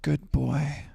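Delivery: lightly different, performed dog praise; sponsored good boy. Acted. A hint of a put-on voice for a paid reading. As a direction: say it like a paid line. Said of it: "I'm gonna say it like this".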